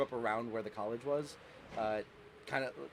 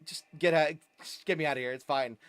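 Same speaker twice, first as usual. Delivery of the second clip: louder, hurried protesting; a flustered passenger insisting he's fine; flustered retold reply; 2 semitones higher, 5 dB louder than usual. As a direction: brush it off loudly, wanting out. Retelling own flustered reply to driver.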